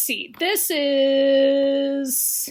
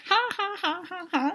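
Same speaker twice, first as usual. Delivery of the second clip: high, spaced-out mock laughter; fake laugh; 6 semitones higher, 4 dB quieter than usual. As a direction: pronounce each ha as pretend laughter. Imitates couple laughing together.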